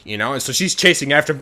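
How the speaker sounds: ordinary delivery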